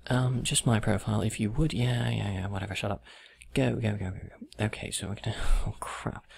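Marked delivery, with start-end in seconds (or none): none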